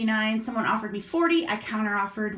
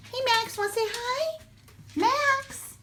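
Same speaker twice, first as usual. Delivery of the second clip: much higher sing-song voice for the dog; pet-talk to the dog; 13 semitones higher than usual. Real, not acted. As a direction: owner greeting her dog Max in a high doggy voice. Calling and greeting the dog Max.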